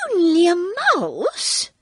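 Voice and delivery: high, loud, drawn-out indignant mouse voice; indignant squeak. A narrator's reading. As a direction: squeak it back as an affronted little animal. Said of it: "cried the little animal indignantly".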